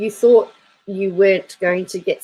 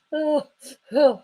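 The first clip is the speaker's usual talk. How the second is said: ordinary talk, nothing marked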